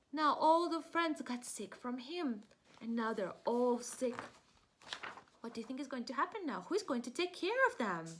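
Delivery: plain reading voice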